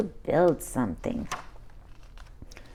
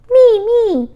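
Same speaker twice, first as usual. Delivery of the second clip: much higher, louder, excited; eager clamor; 11 dB louder than usual. Acted. Brother begging to play in fort, excited squeal.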